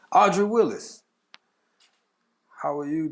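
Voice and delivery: high, loud welcome call; excited greeting. Real, not acted. Streamer greeting a viewer with an excited high call. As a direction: call her name out with delight.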